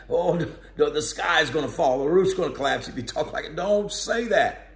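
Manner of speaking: hammed-up fretful king; mock alarm. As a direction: play the king fussing that the sky will fall. Imagined alarmed Jehoshaphat.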